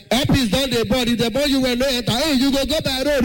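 high, playful pidgin flight announcement; pidgin announcer. Pretend. Imagined pidgin flight announcement to passengers, high and playful. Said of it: "announce our flight in pidgin".

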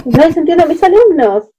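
a light mock of his helpless complaint; imitating husband. Wife imitating husband on phone, a touch of frustration. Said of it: "me dijo".

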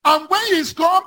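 high, shouted sermon exhortation; full-force preaching. Acted. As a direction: drive the point home like a fiery preacher.

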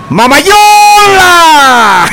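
long high stretched call of Mamayola; drawn-out shout. Acted. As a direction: call it out slow and loud like across a field. Grito de saludo al aire. (grito largo y agudo)

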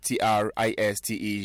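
ordinary talk, nothing marked

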